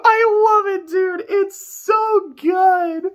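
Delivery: high, laughter-soaked words; giggling out the praise; still laughing. Real, not acted. Reacting to a favorite scene, still laughing.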